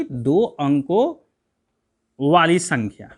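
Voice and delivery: slow, deliberate answer, stress on दो; slow drilled answer. Shown as a demonstration. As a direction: spell out दो अंकों वाली संख्या slowly for the class. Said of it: "कितने अंकों वाली?".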